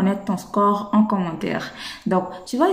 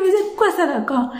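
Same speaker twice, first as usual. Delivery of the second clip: mock bafflement; 9 semitones higher than usual. Teacher imitates a learner shocked by the word. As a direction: squeal like a confused learner. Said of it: "Tu vas dire".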